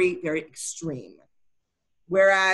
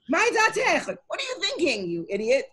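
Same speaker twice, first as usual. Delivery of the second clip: high, pitched-up scolding "what are you thinking"; exasperated rebuke; 9 semitones higher than usual. Shown as a demonstration. Wife Bruria scolding Rabbi Meir for his curse, exasperated. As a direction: snap at him like an exasperated wife. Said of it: "the way that I prefer to read this piece is to say it like this".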